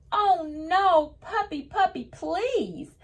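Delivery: high, sing-song pleading read-aloud; storybook reading voice. Acted. Teacher reading the picture book to kids, high sing-song plea. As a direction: read the line like a child calling her puppy.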